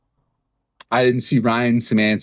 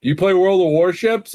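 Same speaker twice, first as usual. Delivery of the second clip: high, eager surprised question; 10 semitones higher, 4 dB louder than usual. Retold or imitated. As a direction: ask it in disbelief. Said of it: "he's like".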